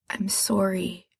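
soft, near-whispered sorry; guilty brother apologising at the bedside, whispering; whispered apology. Acted. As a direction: breathe the apology out softly. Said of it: "Gerard whispers".

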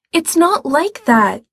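a hurt brother insisting he meant well, protesting. Acted. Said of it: "Gerard protests".

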